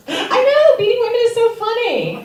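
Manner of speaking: very high, loud sarcastic squeal through laughter; mock the audience's laugh in a shrill put-on voice; high loud sarcastic laughing jab. Mocking audience reaction sarcastically.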